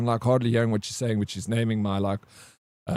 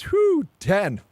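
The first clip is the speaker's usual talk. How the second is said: usual speaking voice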